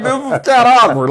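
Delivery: high, loud mock-awkward voice; a hammed-up awkward reaction. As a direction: squeak it out, embarrassed. Retelling his reaction to scene.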